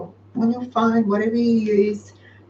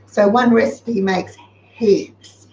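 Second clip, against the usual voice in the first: usual speaking voice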